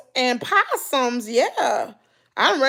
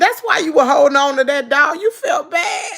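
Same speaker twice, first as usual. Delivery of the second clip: louder, rising into a high laughing cry; laughing teasing; 3 semitones higher, 4 dB louder than usual. Retold or imitated. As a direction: call her out, cracking up by the end. Teasing the wife in retold story, laughing.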